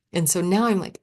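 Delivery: ordinary delivery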